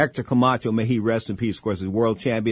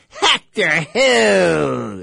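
slow, drawn-out, higher and louder witchy voice; 5 semitones higher, 5 dB louder, much slower than usual. Retold or imitated. Ex-wife answering the phone to Hector, in a witchy tone. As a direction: stretch it out like a sneering witch. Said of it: "the witchiest tone she could ever come up with".

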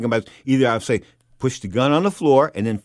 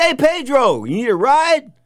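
calling out; 12 semitones higher, 7 dB louder, slower than usual. Retold or imitated. Mitch calling out at cab line.

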